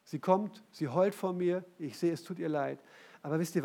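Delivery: ordinary talk, nothing marked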